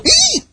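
very high squeal of fright; frightened squeal. Retold or imitated. Believers squeal in fright at the giant's bark. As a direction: squeak like a scared child. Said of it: "And we go".

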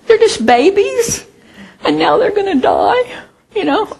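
put-on high, teary voice over the baby shoots; mock tearful pity. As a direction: coo and half-cry over the little leaves. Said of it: "I go to this plant and I say".

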